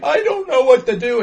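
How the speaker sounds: high, nervous mock teen; awkward teen. Mocking Shia's awkward teen, high and nervous. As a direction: play the clueless awkward kid. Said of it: "he's still acting like".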